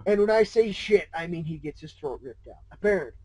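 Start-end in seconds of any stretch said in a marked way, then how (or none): none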